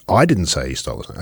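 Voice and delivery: "I" picked out at the start, rest falling away; stress on I. Shown as a demonstration. Host shows course example of emphasis, stress on "I". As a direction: hit "I", let the rest drop. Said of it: "where you put the emphasis in that sentence".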